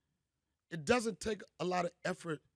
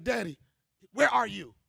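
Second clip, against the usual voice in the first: put-on child voice; 3 semitones higher, 7 dB louder than usual. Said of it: "he was like".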